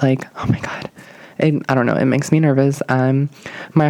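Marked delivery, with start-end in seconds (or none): 0.4-0.9 breathy whispered exclamation; whispered aside. Retold or imitated. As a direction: whisper it to yourself in dread. Overwhelmed thought, voiced aside.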